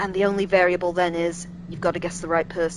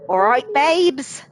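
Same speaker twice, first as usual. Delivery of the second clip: drawn-out, higher and louder Chav English; best Chav accent; 6 semitones higher, 7 dB louder, much slower than usual. Shown as a demonstration. Streamer greets a scared game character in a loud Chav accent. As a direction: put on a loud, slow Essex Chav accent. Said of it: "in me best Chav accent".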